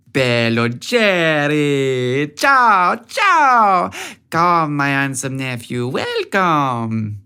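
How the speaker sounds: Italian auntie calling out, arms wide; heavy Italian accent. A narrator's reading. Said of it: "in her heavy Italian accent".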